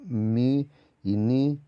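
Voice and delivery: mi y ni drawn out slowly, humming the m and the n; nasals demonstrated. Shown as a demonstration. Teacher sounding the nasal letters for students, slow. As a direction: stretch mi y ni to show the nasal. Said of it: "nasolabial y nasodental".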